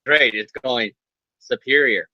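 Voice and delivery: low, understated villain in disguise; deadpan Superior role. Acted. Disguised villain answering Spider-Man about dating, low deadpan. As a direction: answer stiffly in a deep voice. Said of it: "it's Spider-Man like".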